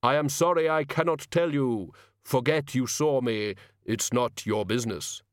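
measured, slowing voice of Death; solemn Death. Acted. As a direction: refuse gravely and deliberately.

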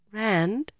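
soft, hesitant call with a rise; tentatively calling his name; uncertain. A narrator's reading. Egwene unsure whether Rand knows her, uncertain. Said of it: "she said uncertainly".

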